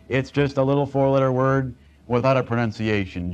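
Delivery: ordinary talk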